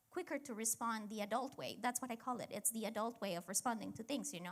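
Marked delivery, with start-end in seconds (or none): none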